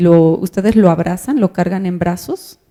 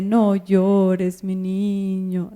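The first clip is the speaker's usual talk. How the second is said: compassionate mother; much slower than usual. Retold or imitated. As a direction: drawl it gently like a mom soothing. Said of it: "el tono de compasión con el que le está hablando". (madre compasiva, lenta y suave)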